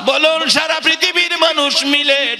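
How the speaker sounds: high, chanted challenge to the congregation; fervent sermon call; rousing preaching. Real, not acted.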